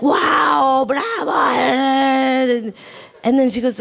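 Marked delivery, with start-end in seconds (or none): 0.0-2.7 high-pitched, drawn-out mock cheer; hammed-up acclaim; crowd cheering. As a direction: imitate an amazed crowd applauding the performance. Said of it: "everybody was like".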